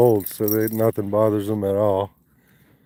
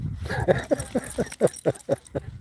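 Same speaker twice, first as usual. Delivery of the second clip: quiet breathy laugh; 7 semitones higher, 9 dB quieter than usual. Real, not acted. Laughing at the bath joke.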